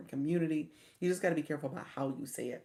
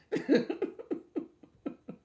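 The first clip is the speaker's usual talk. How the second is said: high, rising airy laugh; real high giggle; 6 dB louder than usual. Real, not acted. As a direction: giggle it away. Laughing at own story.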